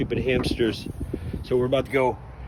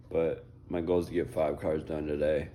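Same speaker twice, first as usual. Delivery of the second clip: ordinary talk, nothing marked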